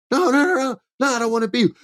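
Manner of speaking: Sami's high-pitched frantic no; squeaky protest. Retold or imitated. Sami protesting to Roman.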